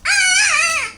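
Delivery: high, loud pretend scream. Toy character crying out in play, loud high scream. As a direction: shriek like a scared toy.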